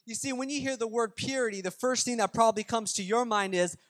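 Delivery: full-force preaching. Real, not acted. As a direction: drive each point home to the congregation. Preaching the main point.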